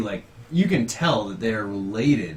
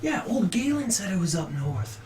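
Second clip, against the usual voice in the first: soft, higher-pitched murmuring villagers; crowd mumbles; 7 semitones higher, 6 dB quieter than usual. Acted. Villagers gossiping about old Galen's sighting, mumbling softly. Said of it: "You hear mumbles amongst the crowd".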